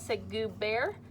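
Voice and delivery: lively storybook reading to a class; reading to children. A narrator's reading. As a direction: read it like story time for little kids. Reads picture book to class.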